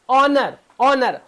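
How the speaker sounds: ऑनर slowly, with a silent H; slow, deliberate ऑनर with no H sound; correct pronunciation. Shown as a demonstration. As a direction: model ऑनर slowly, starting on the vowel. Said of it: "हॉनर नहीं".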